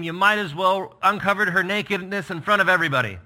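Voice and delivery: loud, high, scolding father; a father berating his son; shaming rebuke. Acted. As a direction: pile the shame onto him. Paraphrasing Saul's insults.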